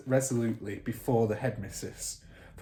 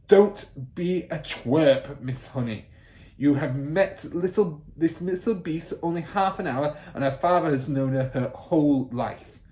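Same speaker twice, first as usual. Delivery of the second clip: contemptuous bark; 4 semitones higher, 6 dB louder than usual. Acted. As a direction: belittle her. Trunchbull voiced.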